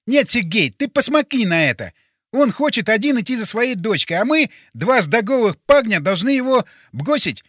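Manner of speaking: high, loud, protesting character voice; indignant Boris. Acted.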